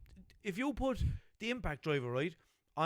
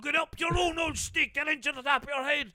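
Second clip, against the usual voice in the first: Limerick father's empty threat, higher and louder; mock-stern Limerick dad scolding; 9 semitones higher, 5 dB louder than usual. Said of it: "Father Pig is my impression of a Limerick father".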